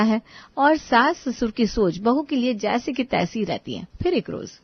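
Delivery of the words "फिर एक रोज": ordinary talk, nothing marked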